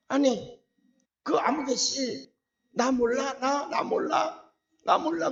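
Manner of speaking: slow, pleading nudge to be recognized; surprised calling out. Retold or imitated. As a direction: reach out to a face you just recognized. Calling out to old student.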